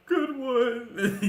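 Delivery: loud, high tearful wail; crying. Acted. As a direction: bawl it out as the hurt captive.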